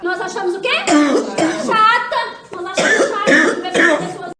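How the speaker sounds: loud rallying call to the crowd; booming call to the church; call-and-response shout. Real, not acted. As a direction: shout it out, laughing at the answer. Preacher calls out to congregation.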